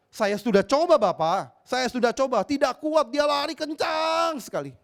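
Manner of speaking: pleading son. Retold or imitated. Son excuses himself.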